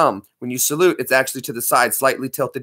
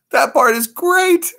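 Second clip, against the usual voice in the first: much higher and slow, pleased; high slow character voice; 12 semitones higher, slower than usual. Acted. Answering the pizza question, high and slow. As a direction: draw it out up high. Reply in a put-on voice.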